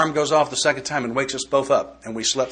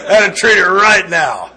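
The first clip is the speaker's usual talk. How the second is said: louder and higher, through laughter; emphatic punchline; 6 semitones higher, 9 dB louder than usual. Real, not acted. Telling the meeting about mornings, loud laughing emphasis. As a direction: drive the point home, half laughing.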